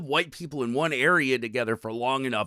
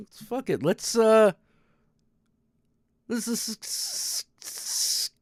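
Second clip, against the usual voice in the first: struggling to speak; 9 semitones higher than usual. Real, not acted. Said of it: "getting a little, little bit of trouble here".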